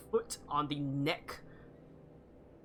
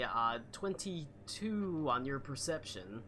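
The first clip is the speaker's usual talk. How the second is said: usual speaking voice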